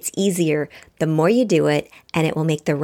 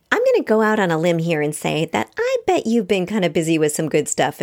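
ordinary talk

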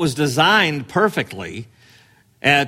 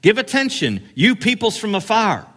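higher, ringing out to the nations; commanding proclamation; 6 semitones higher than usual. Shown as a demonstration. Preacher showing a voice that goes everywhere, ringing. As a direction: proclaim it as if across the seas. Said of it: "He's saying".

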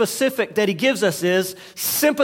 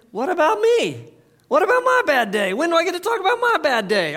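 higher, indignant mock complaint; self-pitying whine; 9 semitones higher than usual. Husband's inner gripe at his wife, mock whine. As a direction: whine like the one who's been wronged.

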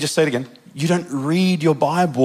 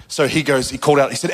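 normal talking voice

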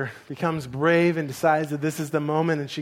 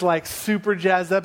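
normal talking voice

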